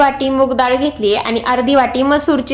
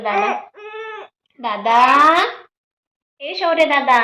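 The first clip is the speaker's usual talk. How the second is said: a rising call to big brother; 4 semitones higher, slower than usual. Real, not acted. Mother calls out to big brother Shaurya, rising call. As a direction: call the brother's name upward.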